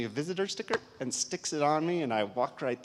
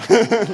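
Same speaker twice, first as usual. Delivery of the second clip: laugh; 9 semitones higher, 16 dB louder than usual. Real, not acted. Laughs after the punchline.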